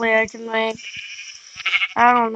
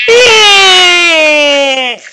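drawn-out, higher and louder yeah; long loud yeah; 6 semitones higher, 9 dB louder than usual. Pretend. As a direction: belt the yeah out. Exterminator triumphant answer.

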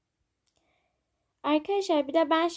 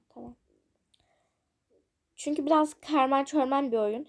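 usual speaking voice

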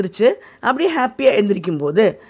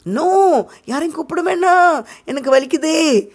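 high, whining fake pain, meaning the opposite; faked pain; 8 semitones higher than usual. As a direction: moan so they will call auntie. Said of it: "அப்படின்னா வலிக்கல". (வலிப்பது போல் நடிக்கும் முனகல் குரல்)

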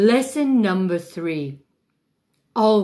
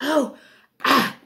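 hammed-up high yelps; squeaky overacted gasps; high gasping cries; 8 semitones higher than usual. Shown as a demonstration. Diva overacting with her props, high yelps. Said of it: "Sometimes you can use all three".

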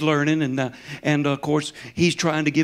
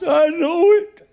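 put-on high, loud slurred drunk reply; drunk man; 12 semitones higher, 7 dB louder, slower than usual. Drunk man answering the preacher, loud and slurred. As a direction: blurt it like a tipsy stranger.

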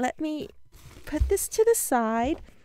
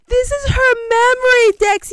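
excited; 9 semitones higher, 9 dB louder than usual. Real, not acted. Presenting the gift.

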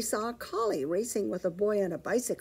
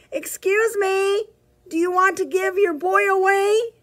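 calling out politely; 11 semitones higher, 6 dB louder than usual. Acted. Petey calling out to the collie, high and polite. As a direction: call across to the dog, sweet but loud. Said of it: "called out loudly".

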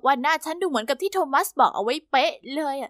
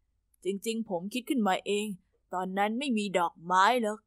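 much softer, slow, lower sighed admission; sighing confession; 6 semitones lower, 6 dB quieter, slower than usual. A narrator's reading. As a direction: let it out on a sigh, quietly. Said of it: "เขาถอนหายใจแล้วก็พูด". (ถอนหายใจแล้วสารภาพเบาๆ ช้าๆ)

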